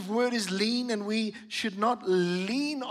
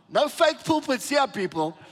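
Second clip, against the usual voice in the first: playful boast; 5 semitones higher, 4 dB louder than usual. Real, not acted. Joking about solid pulpit.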